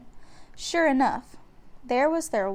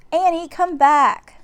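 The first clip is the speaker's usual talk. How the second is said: louder, slow calling out; calling after her; 6 semitones higher, 9 dB louder, slower than usual. A narrator's reading. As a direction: call her back firmly. Jack calls her back.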